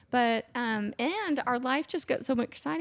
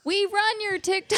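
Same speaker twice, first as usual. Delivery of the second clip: high proud quote; 9 semitones higher, 4 dB louder than usual. Retold or imitated. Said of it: "I was like".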